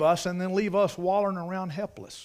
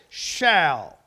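SHALL hit hard with a falling tune; emphatic; 5 dB louder than usual. Shown as a demonstration. Preacher driving home the word "shall" to the congregation, emphatic. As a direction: land firmly on SHALL, letting it fall.

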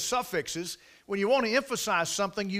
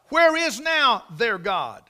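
slow, louder mocking question; skeptic's taunt; 4 semitones higher, 7 dB louder, slower than usual. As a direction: sneer it like a skeptic.

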